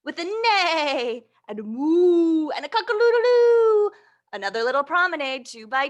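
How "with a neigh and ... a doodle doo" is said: usual speaking voice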